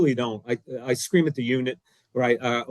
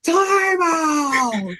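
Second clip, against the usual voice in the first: screaming time out; 13 semitones higher than usual. Retold or imitated. Coach yells to the official, screaming time out. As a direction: yell it at the top of your lungs. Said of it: "I go".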